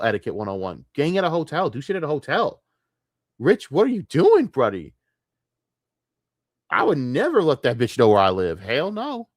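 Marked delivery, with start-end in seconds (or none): 3.4-4.9 louder and higher, calling Rich out; exasperated call-out. Real, not acted. Calling out Rich in disbelief, loud exasperated. 6.7-9.3 indignant outburst. Real, not acted. Ranting at Rich's choice, loud and indignant. Indignant rant about Rich.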